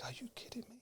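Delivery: whispered disbelief; stunned whisper. Retold or imitated. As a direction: whisper it as a private, astonished thought. Stunned thought in his head.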